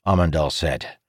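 ordinary delivery, nothing marked

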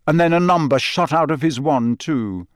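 plain reading voice

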